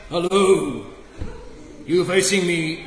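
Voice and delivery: a hint of a booming deity; mock God voice. Preacher voicing God to the kids, mildly mock booming. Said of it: "he doesn't speak like that".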